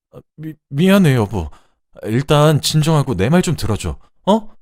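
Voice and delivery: high, loud, stumbling apology; stammering plea. A narrator's reading. Husband trying to calm the angry wife, stammering and pleading. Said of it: "말을 더듬으며 저를 진정시키려고".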